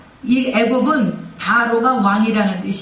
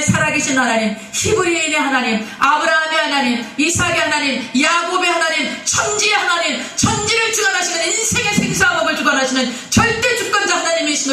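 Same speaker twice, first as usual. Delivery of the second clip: climbing higher with each name of God, rising from plain into a ringing peak; a preacher's litany swelling to a climax; building fervour; 5 semitones higher than usual. Real, not acted. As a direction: pile up the names of God, each one higher. Preacher's rising litany of God's names.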